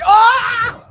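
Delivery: high, loud scream; excited scream. Real, not acted. Screaming at the trailer in delight. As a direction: let out a thrilled scream. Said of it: "apologize for my freaking out in there".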